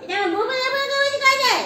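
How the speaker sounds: very high, loud singsong; squealing pet talk. Real, not acted. Owner doting on her Bubla, squealing pet talk. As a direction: squeal it at a beloved animal.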